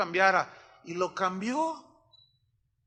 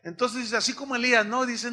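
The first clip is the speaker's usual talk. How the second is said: normal talking voice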